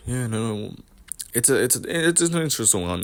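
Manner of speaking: soft, halting interview mumble; Chandler impression. Imitating a fighter sat in his interview, soft mumbled impression. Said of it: "that's my Chandler impression".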